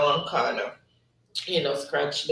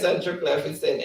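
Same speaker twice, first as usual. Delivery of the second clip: usual speaking voice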